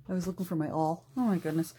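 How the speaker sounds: ordinary talk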